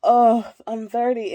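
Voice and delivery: ordinary talk, nothing marked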